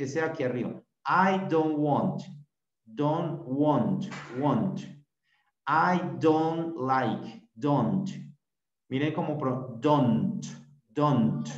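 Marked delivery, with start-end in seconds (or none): 1.1-4.8 slow, carefully separated "I don't want"; demostración de pronunciación. Shown as a demonstration. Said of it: "vamos a pronunciar este". 5.7-8.1 slow, deliberate "I don't like"; demostración de pronunciación. Shown as a demonstration. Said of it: "vamos a pronunciar este". 9.8-11.5 "don't" ending in a released t; final t pronounced. Shown as a demonstration. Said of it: "Termina como en un t".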